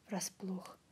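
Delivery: ordinary delivery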